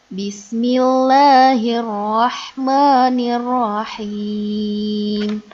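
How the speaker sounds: slow, drawn-out chanted recitation; slow Quran recitation. Shown as a demonstration. Teacher recites slowly before the lesson, chanting. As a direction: intone each word slowly like tilawah.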